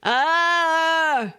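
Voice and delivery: high, loud, pushed "Ah"; pressed sound. Shown as a demonstration. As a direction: squeeze the "Ah" out with pushed air. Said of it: "not a pressed sound".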